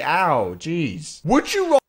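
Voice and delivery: ordinary delivery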